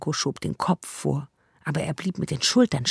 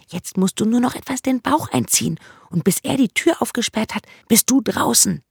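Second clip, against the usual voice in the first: put-on higher, urgent girl's voice; 7 semitones higher, 3 dB louder than usual. Zora urges Branko out before the jailer comes, higher and urgent. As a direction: hurry him along as a bold girl.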